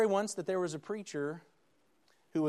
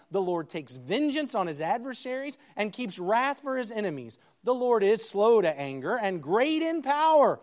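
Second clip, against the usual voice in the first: scripture proclamation; 4 semitones higher, 7 dB louder than usual. Acted. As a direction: thunder out the prophet's words. Preacher reads Nahum on God's wrath.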